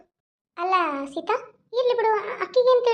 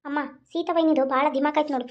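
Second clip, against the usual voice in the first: usual speaking voice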